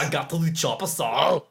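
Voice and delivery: lightly Austrian action-hero voice; Arnold Schwarzenegger impression. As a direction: channel a movie muscleman. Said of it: "Oh, Arnold Schwarzenegger".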